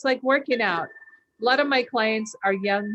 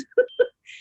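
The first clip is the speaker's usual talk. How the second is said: high laugh; 7 dB louder than usual. Real, not acted. Laughs at her own imitation.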